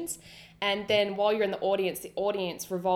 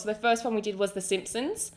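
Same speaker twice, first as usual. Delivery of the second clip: ordinary delivery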